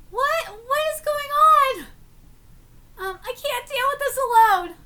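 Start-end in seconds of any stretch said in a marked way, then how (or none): 0.1-1.9 startled. Real, not acted. Startled by event.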